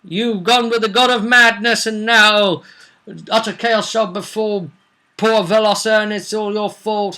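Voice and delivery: hammed-up doom-laden warning; Costello's prophecy. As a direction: condemn the snake people from on high. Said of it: "who said".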